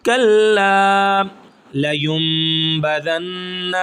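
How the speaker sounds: کلا sung slowly, the final الف held one count; slow chanted Quran reading, long vowels; stretched recitation. Shown as a demonstration. Teacher demonstrates stretching کلا for students, chanted slowly. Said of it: "کلا کو ہم ایک الف کے برابر کھینچیں گے".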